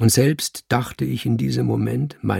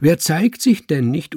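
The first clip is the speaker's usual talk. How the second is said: raised, sharp retort; sharp mimicry; 5 semitones higher, 4 dB louder than usual. A narrator's reading. Cat mimicking the man's voice, sharp. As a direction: call it back in his own sharp tone. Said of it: "rief es wieder mit genau seiner Stimme, genau seiner Schärfe".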